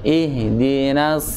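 Ihdinas drawn out very slowly; slow model reading. Shown as a demonstration. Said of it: "coba lagi Pak Komar".

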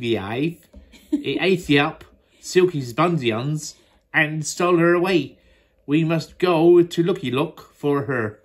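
ordinary delivery, nothing marked

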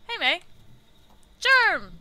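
loud, high shout of surprise; loud, high call to Germ. Acted. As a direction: hail a friend across a distance. Greeting friend from above.

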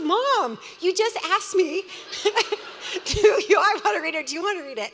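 high, loud, laughing through the son's words; half-laughing mock exasperation. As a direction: crack up while quoting him.

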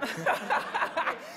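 a high, loud burst of laughter. Real, not acted. Audience laughs at her swearing.

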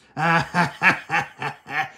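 loud evil villain cackle; evil laugh. Acted. Capping the Taliban tweet with a loud evil villain laugh. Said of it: "I laughed there in such an evil manner".

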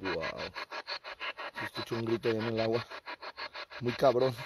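a quick hushed comment to a companion during the session; muttered fast and low, not for the spirit to hear; hushed aside. Real, not acted.

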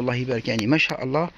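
ordinary delivery

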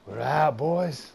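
loud squad call, lifting at the end. Real, not acted. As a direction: raise the voice to rally teammates. Calling out to the squad.

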